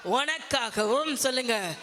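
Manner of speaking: ordinary delivery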